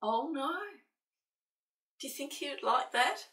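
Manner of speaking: usual speaking voice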